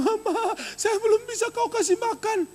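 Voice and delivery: high, laughing mock sob; fake crying. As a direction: ham up a crocodile-tear wail. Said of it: "kau pura-pura nangis, kau bilang".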